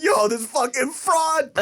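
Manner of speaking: high, drawn-out comic exclamation; mock outraged disbelief. Son reacts to his mom being exposed, high outraged exclamation. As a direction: stretch it out in high, scandalized disbelief. Retold disbelief at mom's fraud.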